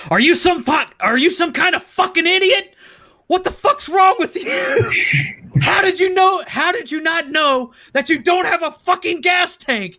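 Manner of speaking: high, incredulous mock rant; hammed-up outraged shouting; dad's angry yelling. Retelling dad's furious tirade.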